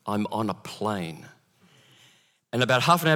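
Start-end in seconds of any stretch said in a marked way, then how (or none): 0.0-1.3 slow and hushed, each word weighted; slow, grave repeat. Real, not acted. Pastor relives being trapped mid-flight, slow grave repeat. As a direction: say it softer, letting dread sink in.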